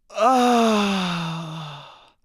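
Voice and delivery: drawn-out mock groan, sliding slowly down; pained groan. Woman elbowed in the head, imitated pained groan. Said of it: "she's just holding her head like this".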